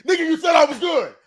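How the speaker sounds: high, loud outraged yell; outraged shout. Retold or imitated. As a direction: protest like you were betrayed. Acted player protest to ref.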